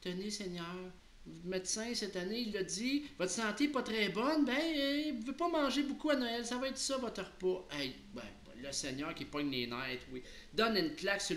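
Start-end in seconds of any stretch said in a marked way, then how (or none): 0.0-7.7 put-on high, timid-servant little girl voice. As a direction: play a small child, pitch climbing as she goes. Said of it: "la petite fille a dit".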